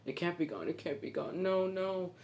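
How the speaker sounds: high, rising panic on no, no; panicked despair. Retold or imitated. Marlin watches the boat vanish, high panicked despair. Father loses his son.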